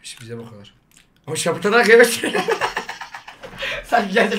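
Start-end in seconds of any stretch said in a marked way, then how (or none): 2.1-3.8 helium laugh. Real, not acted. Laugh after inhaling balloon gas.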